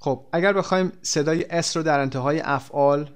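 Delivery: ordinary talk, nothing marked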